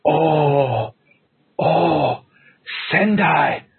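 rising, choked-up recollection in broken English; piecing the memory together through emotion; imitating Kobashi's memories. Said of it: "And then he was like".

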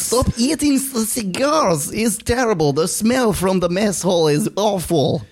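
high, exasperated mock Russian; Russian accent. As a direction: complain in a comic Russian accent. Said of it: "this is my best Russian accent".

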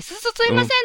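stuttering call. Retold or imitated. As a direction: stammer it out nervously. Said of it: "って噛んだことあります".

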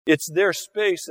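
ordinary talk, nothing marked

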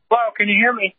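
usual speaking voice

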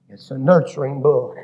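ordinary talk

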